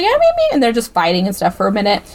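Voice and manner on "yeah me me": mocking high voice. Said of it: "and she's like".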